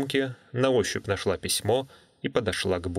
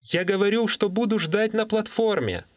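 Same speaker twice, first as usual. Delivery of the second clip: raised voice, higher and louder; 11 semitones higher than usual. Acted. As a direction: speak up over a bad line. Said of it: "Говори громче".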